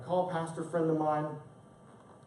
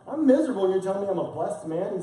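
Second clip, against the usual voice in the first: fast, rising to a louder indignant protest; incredulous retort; 6 semitones higher, 5 dB louder, faster than usual. Retold or imitated. Pastor inwardly protesting his friend's advice, incredulous. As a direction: rush it, disbelieving and stung. I'm thinking.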